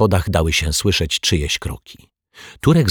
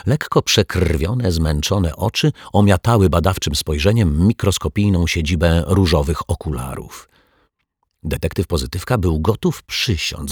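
ordinary delivery

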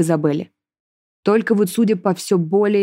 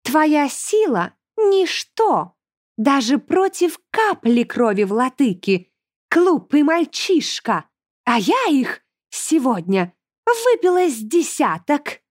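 high, slow, scornful villainess; gloating demoness; 7 semitones higher, slower than usual. A narrator's reading. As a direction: draw out each word, high and contemptuous. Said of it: "расхохоталась демоница".

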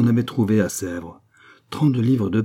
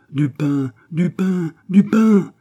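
crowd clamour; 8 semitones higher, 6 dB louder, slower than usual. A narrator's reading. Starving women clamour at the gate for bread, rising shouts. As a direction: demand building to a loud, high cry. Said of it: "répondirent à la fois mille voix".

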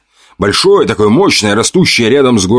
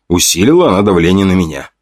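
ordinary delivery, nothing marked

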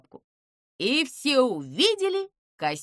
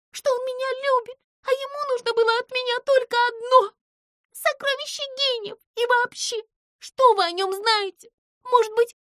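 high squeaky mouse yelling, partly fast; a hurt mouse shouting through a door; yelling from behind door; 14 semitones higher, 6 dB louder than usual. A narrator's reading. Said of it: "заорав из-за двери".

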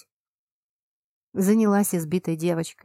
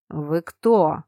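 taken aback; 2 semitones lower, 4 dB louder than usual. A narrator's reading. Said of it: "опешил он".

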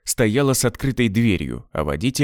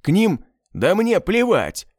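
злобный рявк, выше и медленнее; рявкни на оператора: плевать; рявкнул; 9 semitones higher than usual. A narrator's reading. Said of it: "рявкнул Генрима". (Квестор рявкает на оператора, злобно и медленно)

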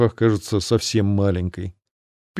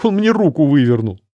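whimpering; 3 semitones higher than usual. A narrator's reading. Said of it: "Аэлла захныкала".

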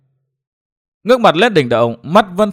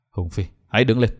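soft, low and heavy after a sigh; lowered voice; 5 semitones lower, 5 dB quieter than usual. A narrator's reading. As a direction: drop to a subdued, sad request. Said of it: "hạ giọng nói". (hạ giọng trầm buồn)

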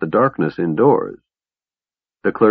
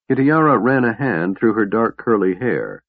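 ordinary delivery, nothing marked

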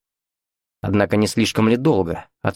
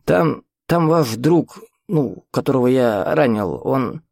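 put-on flustered old man, halting on his words; nervous, stammering old host; agitated old man; 4 semitones higher, slower than usual. A narrator's reading. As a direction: stumble through urgent news, pitched higher. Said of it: "Взбудораженно тараторил он".

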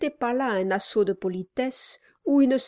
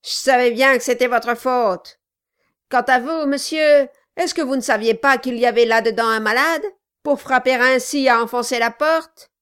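angry; 4 semitones higher, 9 dB louder than usual. Acted. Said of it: "un regard de colère".

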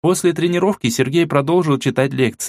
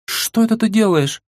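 high, nervous startled question; flustered and nervous; nervous girl; 10 semitones higher, slower than usual. A narrator's reading. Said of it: "как-то нервно сглотнув, спросила Мила". (нервный высокий голос)

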